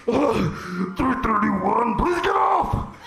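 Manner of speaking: pained scale. Pretend. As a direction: voice the strained scale begging you off. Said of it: "it's like".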